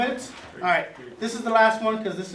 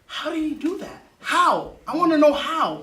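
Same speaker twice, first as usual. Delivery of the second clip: amazed, building; 8 semitones higher, 5 dB louder than usual. Retold or imitated. The angry man later, amazed by gentleness, growing loud. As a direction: pitch rising higher and getting louder, imitating awe. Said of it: "They'll be like".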